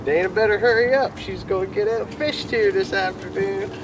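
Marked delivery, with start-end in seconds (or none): none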